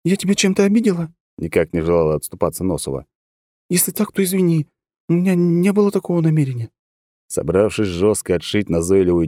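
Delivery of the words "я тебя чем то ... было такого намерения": a woman saying sorry lightly; apologetic woman. A narrator's reading.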